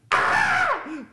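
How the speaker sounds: high, loud mock scream of a girl; shocked scream. Injured model reacting to hairspray on her ear, a high shriek. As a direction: shriek as if suddenly in pain. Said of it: "the girl goes".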